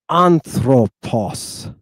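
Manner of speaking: "anthropos" said very slowly, syllable by syllable; slow careful reading. Shown as a demonstration. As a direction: spell out "anthropos" slowly for the class. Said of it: "I'm gonna read a few of these words".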